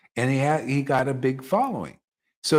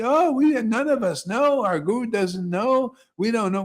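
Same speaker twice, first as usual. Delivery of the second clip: 1 mock helpless disciples; 9 semitones higher, 3 dB louder than usual. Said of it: "what is that mercy".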